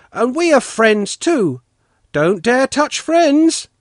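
put-on high, loud boyish declaration; boys copying a girl. A narrator's reading. Envious boys telling Polkan they are friends, high and loud. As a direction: declare it loudly like children, slowing on the warning.

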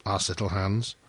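ordinary delivery, nothing marked

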